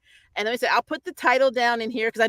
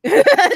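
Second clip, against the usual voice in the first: laugh; 12 semitones higher, 11 dB louder than usual. Real, not acted. Giggling about the princess's crush.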